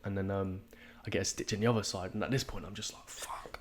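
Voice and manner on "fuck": breathy whispered swear with no voice; whispered curse. Retold or imitated. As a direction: hiss it under your breath in pain. Stitch hits, muttered to himself.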